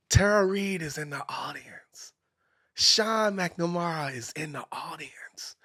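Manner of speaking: hushed, starstruck whisper; slow amazed whisper; awed whisper. Retold or imitated. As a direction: whisper it like you can't believe who's here. Awed aside at celebrities.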